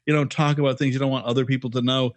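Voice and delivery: ordinary talk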